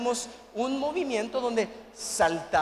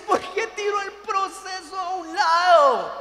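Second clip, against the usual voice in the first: loud, higher preacher's cry; preaching shout; 8 semitones higher, 6 dB louder than usual. Real, not acted. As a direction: hit them with the question. Preacher calling out to audience. (grito de predicador, fuerte y agudo)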